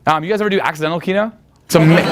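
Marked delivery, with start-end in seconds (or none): none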